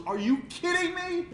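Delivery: high-pitched, loud astonishment; amazed disbelief. Retold or imitated. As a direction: burst out high in delighted disbelief. God reacts with amazement.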